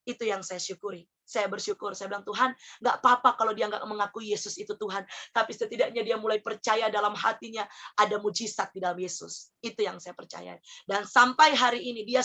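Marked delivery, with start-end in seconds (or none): 0.1-10.6 much softer and lower; hushed, low-pitched reflection on faith; quiet gratitude. Real, not acted. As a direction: drop into a subdued, grateful confiding. Repeats the prayer as grateful reflection.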